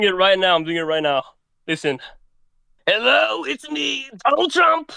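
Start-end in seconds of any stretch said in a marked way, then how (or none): 2.9-4.9 high-pitched presidential impression; Donald Trump. Shown as a demonstration. Caller showing up the host, high Trump impression. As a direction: announce yourself in a high put-on voice. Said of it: "how about you do Donald Trump".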